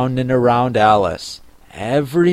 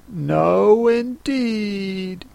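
lightly voiced, higher girl's reply; little girl Alice; 6 semitones higher, 3 dB quieter, much slower than usual. A narrator's reading. Alice answers the Mock Turtle, higher and soft. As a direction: answer gently in a raised girlish voice.

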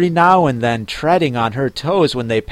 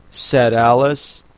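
plain reading voice

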